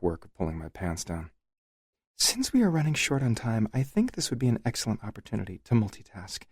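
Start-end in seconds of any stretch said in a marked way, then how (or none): 2.2-6.4 higher, coy wife voice; lightly voiced teasing woman; playful wife. A narrator's reading.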